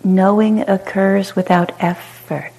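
calm, unhurried meditation guide with long pauses; short soothing phrases, falling gently into silence; guided meditation. Real, not acted. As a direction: guide them into spacious ease, letting each phrase settle.

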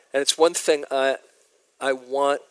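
normal talking voice

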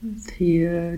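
ordinary delivery

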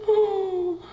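high, quick, fussing mother's voice; soothing mom. Retold or imitated. Mother hurrying to help her crying child, high and fussing. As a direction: rush to comfort like an anxious mom. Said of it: "And mom is like".

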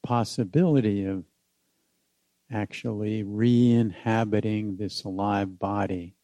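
unhurried meditation guide with long pauses; guided meditation. Acted. Teacher inviting listeners back home in the body, guided meditation.

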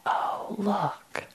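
soft, quiet moment of noticing; hushed realization. Acted. Meditation teacher voices noticing one's own wanting, hushed realization. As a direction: say it softly, as if just noticing.

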